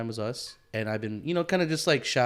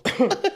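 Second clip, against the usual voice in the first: burst of laughter; 13 semitones higher, 7 dB louder than usual. Real, not acted.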